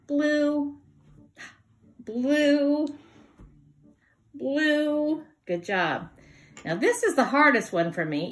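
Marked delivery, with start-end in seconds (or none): 0.1-5.2 high drawn-out blue; singsong sign word. Shown as a demonstration. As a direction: sing it out for kids. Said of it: "you move it side to side".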